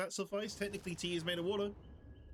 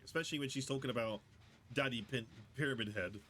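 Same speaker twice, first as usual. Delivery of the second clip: ordinary talk, nothing marked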